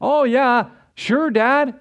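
high, sugary fake-polite teen voice; sweet-talking his dad while planning to skip out; Eddie Haskell. Son humoring his father, high fake-sweet voice. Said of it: "He was an Eddie Haskell".

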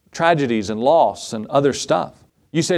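ordinary talk, nothing marked

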